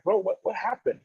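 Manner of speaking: high, stammered, baffled question; anxious confusion. Retold or imitated. Player asks fellow import what happened, bewildered. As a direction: ask a friend in retold confusion, voice lifted.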